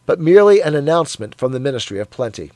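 usual speaking voice

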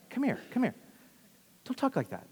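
hushed, urgent reproach; confidential aside. Acted. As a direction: pull them aside and correct them quietly. Peter takes Jesus aside to correct him.

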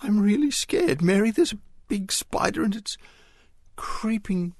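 put-on a boy with a spider down his back, barely holding it together; terrified croak. A narrator's reading. Arthur telling Mary about the spider, terrified. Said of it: "croaked Arthur, terror constricting his voice".

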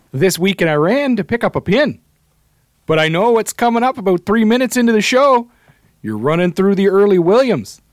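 put-on high, loud mid-Canadian regional accent; Wisconsin-y Canadian accent. Reading a listener's excited pinball message, Wisconsin-y Canadian accent. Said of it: "He's like your Wisconsin-y accent".

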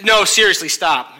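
mock exasperated shout; insistent loud refusal. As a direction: shut them down for real this time. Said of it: "and Jesus is like".

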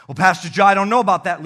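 loud, doubtful heckler voice; a doubter calling out his objection to the pastor; skeptical churchgoer. Pretend.

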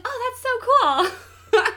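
high, loud, drawn-out mock awe; clueless delight. Retelling his oblivious reaction to the doll-sized woman, mock delighted. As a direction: gush like someone happily missing the point.

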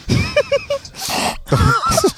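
very high squealing laughter; real helpless high laughing; high-pitched laugh. Real, not acted. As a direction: break into a long, very high laugh. Reacts to the race video.

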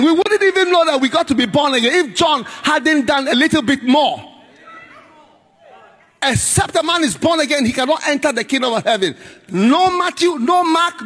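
high, shouted revival preaching; preaching at full force. Acted. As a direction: pound each question home at the congregation.

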